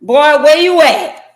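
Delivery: loud high demanding call; yelling on the phone. Acted. Wife calling the absent husband, loud high demand. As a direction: shout down the phone at him.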